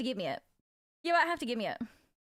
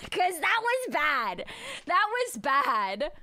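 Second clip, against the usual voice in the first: laughing; 4 semitones higher, 4 dB louder, much slower than usual. Real, not acted. Laughing at memory.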